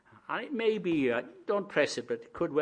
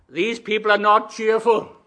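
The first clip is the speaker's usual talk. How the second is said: normal talking voice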